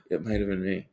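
hushed, stunned self-realisation; dawning realisation. Retold or imitated. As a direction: say it softly as it sinks in. Acting out a sudden dawning realisation.

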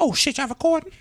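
soft, very high startled mom voice; mock being caught off guard, hushed; squeaky little rising question; imitated mom. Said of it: "She'll be like".

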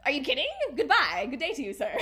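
loud, hammed-up incredulous farewell; exaggerated sassy goodbye; mock dismissal. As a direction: send them off theatrically, half laughing. Acts out dumping him.

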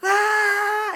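sudden high, loud joyful cry; excited cry. Retold or imitated. Israelites cry out as Jericho's walls fall. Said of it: "how excited everybody got".

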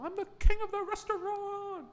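put-on childish shout. Recalling childhood birthdays, high-pitched childish proud shout. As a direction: proclaim it like a bragging child. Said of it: "As a child".

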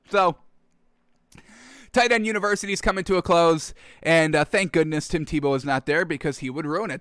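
usual speaking voice

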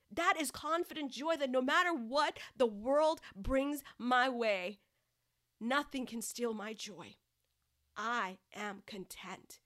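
normal talking voice

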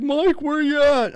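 high, slow goofball call; goofy goon. Retold or imitated. Goofy co-worker phones looking for Mike, high drawn-out call. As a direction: call out like a big clueless goof. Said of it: "he was, like, a goof".

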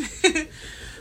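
short airy laugh; breathy laugh. Real, not acted. Laughs after rhetorical question.

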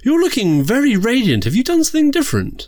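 high, fast, gushing camp diva; campy drag queen. Acted. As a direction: fawn over him like a drag diva. Host persona.

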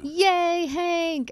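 high, bright cheer. Acted. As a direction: cheer him on. Cheering host's progress.

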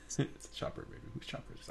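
unvoiced whisper. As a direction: whisper the quote under breath. Quoting Pulp Fiction, whispered.

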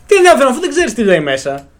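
high-pitched exasperated comeback; mock-indignant retort. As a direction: retell an incredulous challenge, pitch jumping up. Retold retort to the bluffing classmate.

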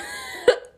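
high squeak of laughter; incredulous laugh. Real, not acted. Bursts out at the story.

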